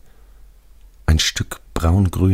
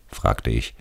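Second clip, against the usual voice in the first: ordinary delivery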